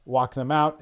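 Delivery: ordinary talk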